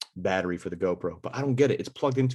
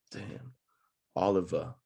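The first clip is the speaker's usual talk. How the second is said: ordinary delivery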